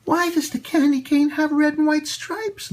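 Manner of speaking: put-on high, light little-girl voice; child voice. A narrator's reading. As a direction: ask it like a curious little girl. Lucy asks about the stripes.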